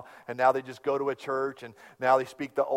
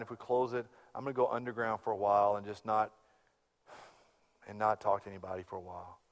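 soft, low, defeated retelling; hushed and downcast, voicing a friend; quiet dejected friend; 6 semitones lower, 9 dB quieter than usual. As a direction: murmur it like a beaten man. Pastor retells friend's despairing call.

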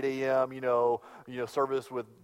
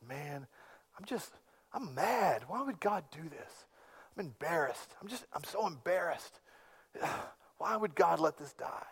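hushed, pained disbelief with a sigh; hurt, bewildered friend; 11 dB quieter than usual. Retold or imitated. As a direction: let the questions hang, wounded. Friend's anguished quote retold.

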